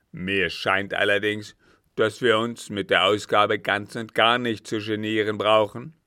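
loud, bitter captain's voice; angry captain. A narrator's reading. Captain answering the count after the note, angry. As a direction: throw it out with angry force.

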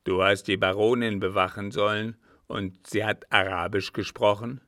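put-on loud, suspicious old captain; gruff, forceful questioning; stern interrogation. A narrator's reading. Alarmed captain interrogates servant.

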